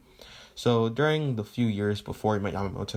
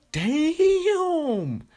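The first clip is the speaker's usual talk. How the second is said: huge high-pitched, drawn-out whoop; President Mike; 6 dB louder than usual. Acted. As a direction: stretch it out loud and very high, hugely impressed. President Mike reacts to the takedown.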